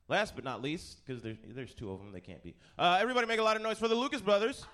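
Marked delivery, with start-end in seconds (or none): 2.8-4.7 loud, fast, higher hype call; shouted announcer intro. Acted. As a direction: shout the act onto the stage. Introducing panelists.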